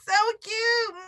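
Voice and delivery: high, forced sweet "so cute"; high put-on coo; fake polite compliment. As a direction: coo it the way you would at an ugly pet. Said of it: "You're like".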